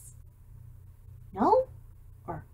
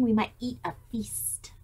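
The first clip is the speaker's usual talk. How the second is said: plain reading voice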